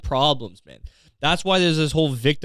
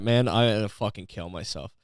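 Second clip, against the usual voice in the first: ordinary delivery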